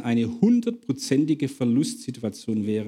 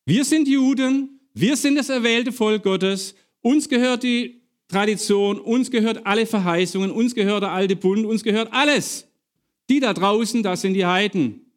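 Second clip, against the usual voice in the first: higher-pitched, insistent list of claims; indignant Peter; 7 semitones higher than usual. Retold or imitated. Preacher acts out Peter's refusal of the heathens, indignant. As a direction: declaim it proudly, piling claim on claim. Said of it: "Petrus sagt, auf gar keinen Fall".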